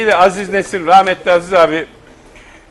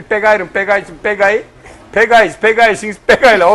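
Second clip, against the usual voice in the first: put-on louder, drawn-out Laz-accented recitation; 3 semitones higher, 4 dB louder, slower than usual. Laz pupil conjugating for the teacher, thick Laz accent. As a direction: rattle off verb forms in a comic Laz accent. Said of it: "Laz oldu demiş ki".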